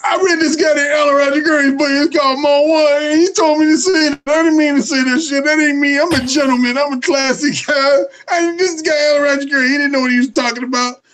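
a guy backpedaling in panic, mock pleading; backing down. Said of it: "He's gonna be saying".

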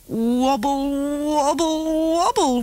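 wobble, wobble, wobble, rising each time; wobbly child voice. A narrator's reading. Girl learning to ride, rising sing-song wobble. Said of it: "went Topsy".

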